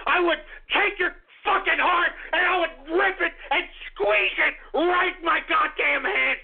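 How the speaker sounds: usual speaking voice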